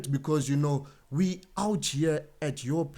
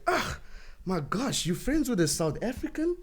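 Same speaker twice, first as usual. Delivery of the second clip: high, incredulous mock of a disapproving Congolese; 9 semitones higher than usual. A Congolese acquaintance scoffing at a South African friendship, high and incredulous. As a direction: pitch it up in outraged disbelief. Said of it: "they'll be like".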